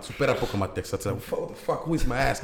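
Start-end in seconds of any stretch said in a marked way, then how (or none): 1.4-2.4 higher-pitched mock-startled outburst; exaggerated bewilderment; mock shock. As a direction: act out a stunned gig-goer, half joking. Acts stunned listener at loud gig.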